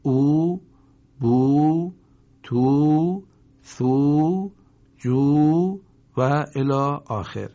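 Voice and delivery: each syllable held on a long, loud "oo"; long waw vowel. Shown as a demonstration. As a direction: stretch the vowel out as you recite. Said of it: "واو مدی بخوانید".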